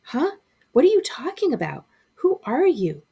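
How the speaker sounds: high, loud panicked questions; frightened shout. A narrator's reading. Boy shouts fearfully at the faceless shadow kids. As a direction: demand answers in fear.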